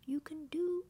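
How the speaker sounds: high-pitched, encouraging bear voice; high storybook bear giving encouragement; Pooh. A narrator's reading. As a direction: cheer a friend on in a high character voice. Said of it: "what his best friend Pooh would".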